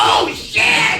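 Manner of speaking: high, drawn-out mock panic yell; hammed-up shout of dismay; sudden panicked shout. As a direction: cry out in comic alarm. Sudden urge while driving, acted.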